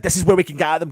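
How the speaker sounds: normal talking voice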